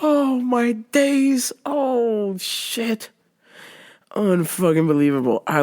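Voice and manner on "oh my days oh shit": long winded-down sigh of amazement; drawn-out exclamation. Real, not acted. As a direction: stretch it out, spent from laughing. Recovering from laughing fit.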